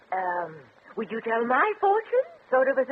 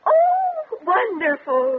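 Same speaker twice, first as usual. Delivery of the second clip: high, loud delighted gasp on oh; thrilled gasp; 9 semitones higher, 8 dB louder, much slower than usual. Acted. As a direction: burst out with joy at the good news. Spinster thrilled by the fortune.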